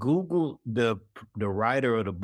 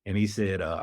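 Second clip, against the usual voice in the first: ordinary talk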